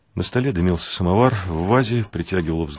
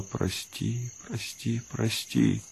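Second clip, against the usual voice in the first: very soft, higher, repeated plea; tearful pleading; 4 semitones higher, 10 dB quieter, much slower than usual. A narrator's reading. Woman kissing her beloved through tears, softly pleading. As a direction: beg forgiveness in a small hushed voice. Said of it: "не обращая внимания на бегущие по лицу слёзы, повторяла".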